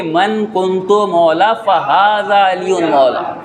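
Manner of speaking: drawn out, each word weighed; slow solemn recitation. Acted. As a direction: recite it slowly for emphasis. Preacher slowly recites the hadith. (آہستہ، ٹھہر ٹھہر کر تلاوت)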